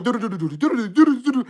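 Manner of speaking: rapid-fire imitated game beeps; very fast machine-like patter; going so fast. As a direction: rattle off a run of do-do sounds. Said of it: "That bitch was going so fast".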